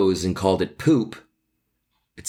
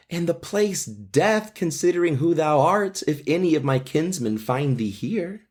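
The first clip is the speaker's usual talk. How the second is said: higher, worried Juliet voice; frightened young girl, read higher; anxious Juliet; 9 semitones higher than usual. Acted. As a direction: ask him urgently, pitched up. Reads Juliet alarmed.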